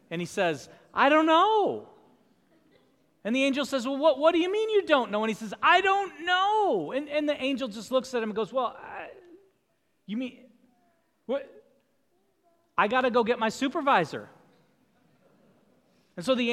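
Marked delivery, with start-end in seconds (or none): 0.9-1.9 high, loud, lost little voice of the thief; bewildered thief. Acted. Thief tells the angel he doesn't know, high and bewildered. 3.8-5.2 incredulous angel. Acted. Angel questions the thief. 5.6-6.9 high, loud, lost little voice of the thief; bewildered thief. Acted. Thief tells the angel he doesn't know, high and bewildered. 8.5-14.3 flustered angel. Acted.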